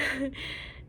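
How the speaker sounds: short nervous giggle; nervous laugh. Real, not acted. Telling a sad pet story with a nervous giggle. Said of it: "I don't know why I'm laughing".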